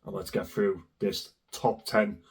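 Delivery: low, soft, hoarse sick voice; hoarse from a cold. Real, not acted. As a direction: rasp like a heavy smoker with flu. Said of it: "I sound like I've smoked sixty cigarettes".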